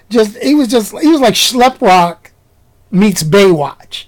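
normal talking voice

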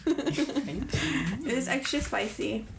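slow, through laughter; laughing. Pretend. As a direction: giggle the words out slowly. Joking about a bug snack.

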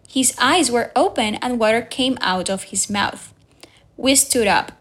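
ordinary delivery

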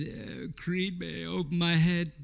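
put-on mumbled half-drunk Rocky; Rocky mumble. Preacher hamming up a garbled Rocky line, mumbled. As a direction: mush the words like a punchy boxer. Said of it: "he sounds like he's half drunk".

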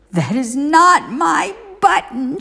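high, pushed mock shout; angry Toad shouting at Frog. A narrator's reading. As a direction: throw it out in a storybook temper. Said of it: "shouted Toad".

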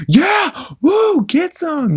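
high-pitched gleeful whoops, imitating a cartoon character; mock thrilled whooping. Joe bouncing wildly on his new trampoline, mock thrilled whooping. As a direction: whoop it up in a squealed impression. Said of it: "having the time of his freaking life".

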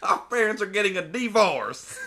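high, loud mock-cheerful whine; silly kid voice. The kid announcing his parents' divorce, hammed-up high cheer. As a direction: chirp the bad news like an overjoyed kid.

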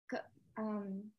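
ordinary talk

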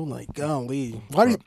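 normal talking voice

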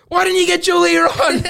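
high loud laughing yell; drunk yelling. Retold or imitated. Drunk brother yelling at him, high and laughing. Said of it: "it was like".

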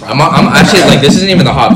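loud, laughing through the words; laughing loudly. Real, not acted. Laughing, louder after choking.

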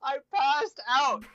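high, loud, drawn-out insistence; emphatic confirmation. Real, not acted. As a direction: stretch it out, loud and high, in disbelief. Amazed confirmation to incredulous listener.

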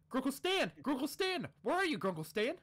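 high, loud calls across the house; calling out. Acted. Dipper searching the house, calling for Stan loudly. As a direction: shout for him from far away.

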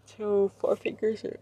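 talking through a yawn. Real, not acted. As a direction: say it mid-yawn. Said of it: "I keep yawning".

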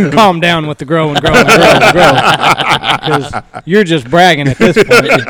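chuckling through the words; dad laughing as he answers his son; laughing reply. Retold or imitated. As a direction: laugh while telling the kid off.

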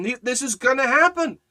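rising, loud revival preaching with voice climbing high; full-force preaching. Acted. Preacher building the scene of the cross for the congregation, thundering. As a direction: preach it to the crowd, building until you're shouting.

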